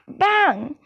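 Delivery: ordinary delivery, nothing marked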